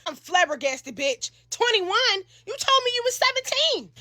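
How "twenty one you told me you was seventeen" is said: higher, stunned retort; teen realizing she was lied to; shocked disbelief. Retold or imitated. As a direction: throw the betrayal back.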